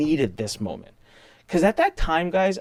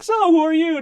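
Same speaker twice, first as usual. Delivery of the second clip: attorney asking; 6 dB louder than usual. Retold or imitated. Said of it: "he's like".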